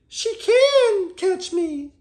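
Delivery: high, slow squeaky little voice; gingerbread man voice. Acted. The gingerbread man admits defeat, high and slow squeak. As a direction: squeak it out like a tiny cookie man.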